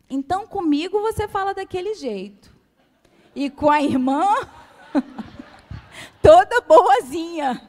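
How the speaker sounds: a hint of a tearful, indignant child, rising to a loud end; mock the daughter's tearful reproach; imitating crying daughter. (imitando a filha chorosa, um pouco mais alto no fim)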